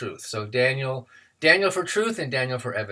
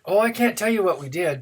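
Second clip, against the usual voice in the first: lightly acted, higher-pitched protesting boy; reluctant teenage boy; 7 semitones higher than usual. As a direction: lightly play a boy refusing to confess. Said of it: "And the boy said".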